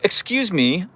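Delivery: high, hammed-up French accent; mock French. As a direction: call the waiter in over-the-top French. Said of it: "go up to someone and be like".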